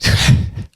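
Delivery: breathy nervous laugh. Real, not acted. Nervous laugh before reveal.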